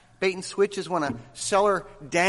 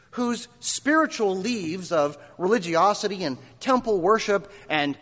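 normal talking voice

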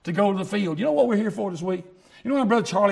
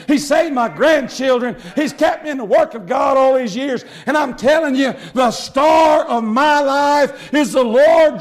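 slow, loud fervent preaching; 4 semitones higher, 5 dB louder, slower than usual. Acted. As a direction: hammer each line home slowly. Preaching climax.

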